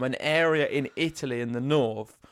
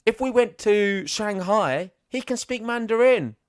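rising, louder disbelief, building with each city; piling up amazement, voice climbing higher; excited; 7 semitones higher, 5 dB louder than usual. Real, not acted. Host marvelling to co-host at Djokovic's languages, excited and high.

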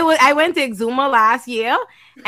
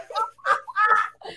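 high squealing laugh; burst of laughter; 9 semitones higher, 5 dB quieter than usual. Real, not acted. Laughing at the lawyer.

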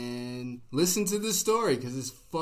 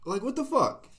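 pitched up outraged what the fuck; high indignant disbelief; 11 semitones higher, 4 dB louder than usual. Real, not acted. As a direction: squeak it out in disbelief. Indignant reaction to story.

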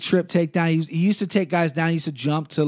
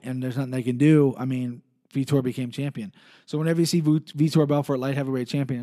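normal talking voice

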